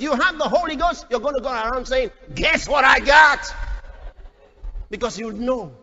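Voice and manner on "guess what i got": loud, drawn-out boastful shout; exuberant boast. Acted. New believer boasting to everyone, exuberant shout. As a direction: crow it out slowly and loudly.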